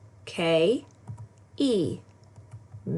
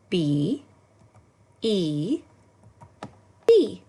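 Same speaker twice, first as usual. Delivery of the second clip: cheery spelling; much slower than usual. Shown as a demonstration. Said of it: "Let's say it again, together".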